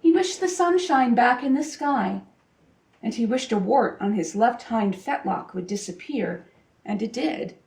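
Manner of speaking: ordinary delivery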